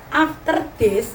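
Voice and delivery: put-on slow, heavy Javanese accent on "After this"; Javanese-accented English. Mother imitating daughter's Javanese-accented English, slow. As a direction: lightly mimic daughter's thick Javanese-accented English, drawn out. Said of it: "tolong ngomong bahasa Inggris yang medok".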